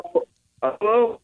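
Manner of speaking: high, faint voice over a breaking-up phone line; calling out through a bad connection. Real, not acted. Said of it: "You got a terrible connection".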